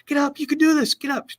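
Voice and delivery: high mock yell of get up; retold coach yell. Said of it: "the coach is screaming from the stands".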